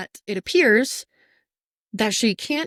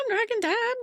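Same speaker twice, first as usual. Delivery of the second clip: excited squeal. Real, not acted. Gushing fan squeal.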